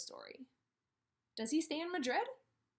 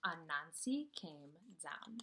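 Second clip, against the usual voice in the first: soft; 5 semitones lower, 6 dB quieter, slower than usual. A narrator's reading. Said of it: "Very soft".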